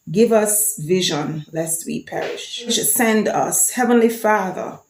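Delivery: slow, measured recital of the anthem words; anthem recitation. Shown as a demonstration. Vlogger recites Jamaica's anthem for viewers, slow measured recital. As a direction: speak each line of the anthem slowly and deliberately.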